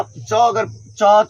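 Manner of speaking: च stretched very slowly, each sound held; drawn-out sound demo. Shown as a demonstration. Teacher to students, very slow sounded-out च. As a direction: let the class hear every part of च. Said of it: "अब देखिए, च का".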